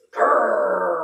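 playful pretend growl; mock growl. Shown as a demonstration. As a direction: show a silly mean growl. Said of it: "Let me see a mean face".

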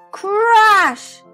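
ordinary delivery, nothing marked

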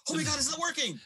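high-pitched panicked cry. Acted. David punching the man to no avail, panicked.

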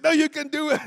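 high, loud insistence; imitating a pumped-up spotter; insistent encouragement. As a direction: shout down his doubts.

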